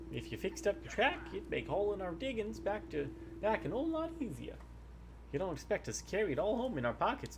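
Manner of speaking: put-on folksy old miner giving a job; old prospector. Reads the NPC lines aloud.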